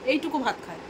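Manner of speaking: soft muttered echo of এইটুকু ভাত খায়; quiet repeat. Real, not acted. Repeats complaint as aside. (নিচু গলায় আবার বলা)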